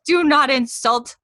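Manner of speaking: high, loud playful scolding; mock indignation. Podcaster defending Scottish word to her co-host, mock indignant.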